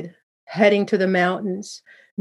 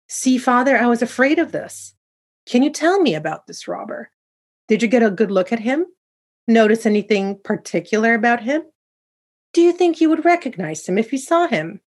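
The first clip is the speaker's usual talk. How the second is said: high-pitched, eager questioning; curious; 5 semitones higher, 4 dB louder than usual. A narrator's reading. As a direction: probe father with rising curiosity, voice lifted. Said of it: "I inquired curiously".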